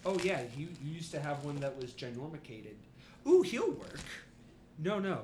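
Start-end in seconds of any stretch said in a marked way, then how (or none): 3.3-4.0 high delighted coo; thrilled, voice jumps up; excited ooh. Real, not acted. GM excited reaction.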